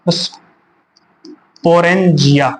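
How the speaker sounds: drawn-out term. Shown as a demonstration. Teacher drilling sporangia very slowly for the class. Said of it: "क्या बोलते हैं?".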